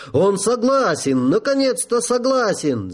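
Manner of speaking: high triumphant yelp; yelling with joy. A narrator's reading. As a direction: whoop it out in triumph. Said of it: "завопил генерал". (радостный вопль)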